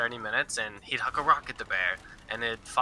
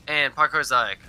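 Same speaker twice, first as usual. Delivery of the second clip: normal talking voice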